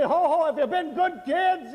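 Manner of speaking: jolly Santa greeting, pitched high. Retold or imitated. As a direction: boom it out like Santa to the children. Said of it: "And I'm saying".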